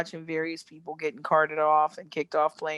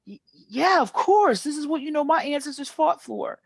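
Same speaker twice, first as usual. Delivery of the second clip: pitched-up imitation of a proud man; proud Southern guy; 8 semitones higher than usual. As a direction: play him defending the flag with pride. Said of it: "he's like".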